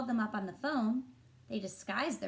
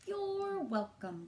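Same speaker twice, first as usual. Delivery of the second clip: sweet Lily; 10 semitones higher, slower than usual. Acted. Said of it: "said Lily".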